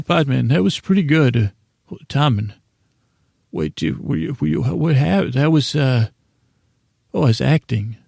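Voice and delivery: normal talking voice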